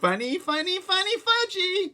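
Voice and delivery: high, loud singsong chant; gleeful toddler. A narrator's reading. Toddler chanting gleefully at his mother. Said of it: "Fudge said".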